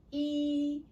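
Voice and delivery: "E" held high and level; flat first tone. Shown as a demonstration. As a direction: sustain "E" on one steady high pitch. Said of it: "It's high pitch and consistent".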